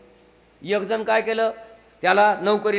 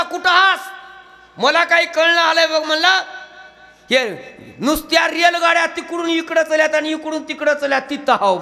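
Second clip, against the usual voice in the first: rustic farmer calling; 9 semitones higher, 5 dB louder than usual. Retold or imitated. Farmer describing station on phone.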